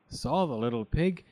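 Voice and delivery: ordinary delivery, nothing marked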